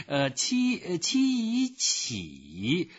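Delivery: high, drawn-out sounding-out; halting, stretched syllables with hesitations; spelling it out. A narrator's reading. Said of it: "建议他最好把字拼出来".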